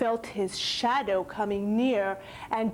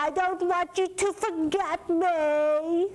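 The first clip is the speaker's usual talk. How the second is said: begging monster; 8 semitones higher, 3 dB louder than usual. Acted. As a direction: plead like a tiny frightened creature. Monster's plea.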